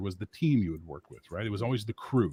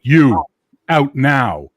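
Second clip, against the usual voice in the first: loud, raised order with a pause after YOU; commanding call; 4 semitones higher, 12 dB louder, much slower than usual. Retold or imitated. Bouncer ordering a dancer off the floor, commanding. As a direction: order him out loudly.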